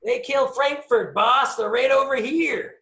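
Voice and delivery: high, loud holler to the boss; screaming over. Acted. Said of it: "as Jimmy screams, screams over".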